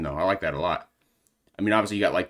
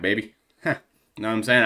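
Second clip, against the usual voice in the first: ordinary talk, nothing marked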